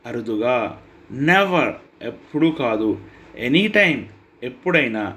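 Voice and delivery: ordinary talk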